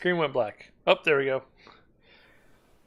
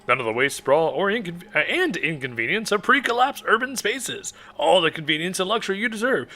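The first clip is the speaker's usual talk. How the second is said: upbeat, higher commercial voice; ad read; 7 semitones higher than usual. Acted. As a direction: sell it like a radio ad. Reads an in-game ad aloud.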